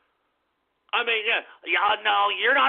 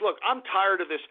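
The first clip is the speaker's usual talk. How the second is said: usual speaking voice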